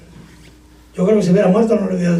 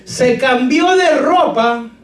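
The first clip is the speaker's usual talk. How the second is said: slow, raised solemn reading of "se cambió de ropa"; scripture reading; 6 semitones higher, much slower than usual. Acted. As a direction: intone "se cambió de ropa" like a Bible verse.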